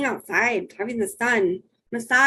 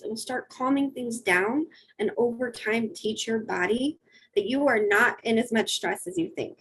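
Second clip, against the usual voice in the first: ordinary delivery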